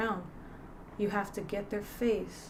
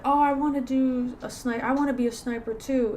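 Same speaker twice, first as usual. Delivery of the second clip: eager recruit; 5 semitones higher, 6 dB louder than usual. Retold or imitated. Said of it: "one guy said".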